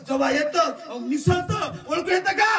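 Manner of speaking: booming high preacher's cry to the crowd; fiery rally orator; preaching at full force. Acted. Preaching climax.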